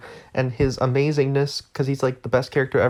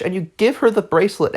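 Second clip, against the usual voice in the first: ordinary delivery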